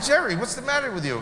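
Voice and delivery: retold exasperation, pitched high; exasperated protest. Speaker protests to his friend Jerry about meeting a terrorist, exasperated.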